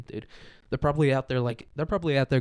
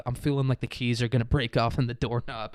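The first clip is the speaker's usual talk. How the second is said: usual speaking voice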